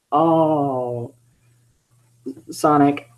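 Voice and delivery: ordinary delivery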